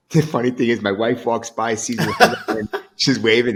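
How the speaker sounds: loud, amused telling through laughter; laughing while talking. Real, not acted. Storyteller reaches the funny part about his wife, laughing. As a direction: let real laughs break into the words.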